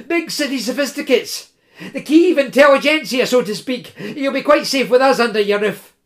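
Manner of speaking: put-on high, wheedling, pleading young fellow; pleading student. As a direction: coax and wheedle the old woman. Koma pleading with old woman.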